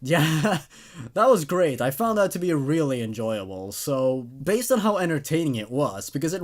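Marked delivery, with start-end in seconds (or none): none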